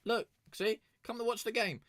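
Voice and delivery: high, squeaky pleading; mock pleading. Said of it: "I was like".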